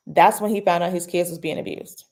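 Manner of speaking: ordinary talk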